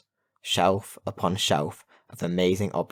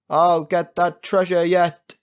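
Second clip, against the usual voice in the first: loud, high, slow defiant yell; pirate's falling shout; 10 semitones higher, 7 dB louder, slower than usual. A narrator's reading. Pirate shouting as he falls, defiant yell. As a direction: shout it drawn out while plummeting. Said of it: "he shouted as he fell".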